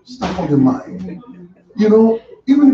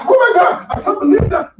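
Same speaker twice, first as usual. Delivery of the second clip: high, loud hammed-up freak-out; exaggerated alarm, pitch shooting up; mock panic; 14 semitones higher, 5 dB louder than usual. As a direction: act out an over-the-top panicked cry. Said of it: "he didn't panic".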